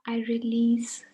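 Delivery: leading a relaxation, letting each phrase settle; guided meditation. Acted. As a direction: guide listeners into calm, phrase by unhurried phrase. We are stepping onto a guided meditation.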